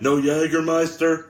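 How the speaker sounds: punchy wrestler impression; Stone Cold Steve Austin. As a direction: call it out for the crowd's response. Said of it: "this is your boy, Stone Cold Steve Austin".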